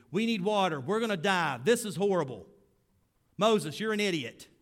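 loud, high mock-angry outcry; angry whining. Israelites yelling insults at Moses, loud high whine. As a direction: shout down Moses like a fed-up mob.

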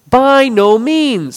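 high, loud, slow "By no means"; slow ringing denial; emphatic. Shown as a demonstration. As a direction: stretch out "By no means" forcefully. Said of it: "the Greek does have a way of showing emphasis".

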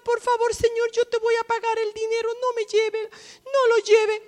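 súplica aguda, un toque de llanto fingido; viuda suplicando. Acted. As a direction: ruega con voz más alta. (la viuda suplica al cobrador, voz aguda y afligida)

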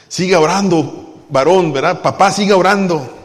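loud, pressing preacher call; urgent exhortation. Acted. Pastor urging fathers and men loudly to keep praying. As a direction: push each listener to keep praying.

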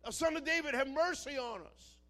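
desperate, high, loud cry for mercy; full-voiced high shout; screaming plea. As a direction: scream it out to someone walking ahead. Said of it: "They're screaming".

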